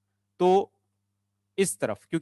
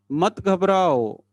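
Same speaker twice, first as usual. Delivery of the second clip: louder, slow singsong call; drawn-out encouragement; 2 semitones higher, 5 dB louder, much slower than usual. Real, not acted. Teacher telling students not to panic, loud drawn-out call. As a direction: call it out long, letting the end fall. Teacher reassuring class with chant.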